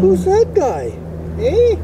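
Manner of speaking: calling out. Real, not acted. Calling out to the cat.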